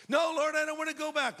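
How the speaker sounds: Paul digging in his heels, whining; whiny refusal. Acted. Paul whines to the Lord that he won't go back, high and pleading.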